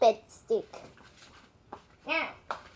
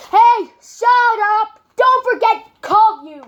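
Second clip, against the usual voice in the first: angry shout; 6 semitones higher, 12 dB louder than usual. Real, not acted. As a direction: yell at them to stop. Yelling at others off camera.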